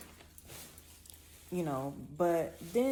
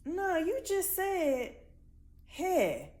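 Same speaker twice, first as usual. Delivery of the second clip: much higher, slow challenging Nah; quoting herself; 10 semitones higher, 4 dB louder, much slower than usual. Retold or imitated. Speaker retelling challenging the stranger, high and slow. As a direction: drag out a sceptical high Nah. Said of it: "and I was like".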